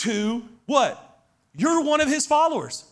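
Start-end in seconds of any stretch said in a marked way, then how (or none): 1.5-2.8 high, pointed accusation; sharp accuser calling him out; accusing voice. Acted. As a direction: point the finger at Peter. Servant girl accuses Peter.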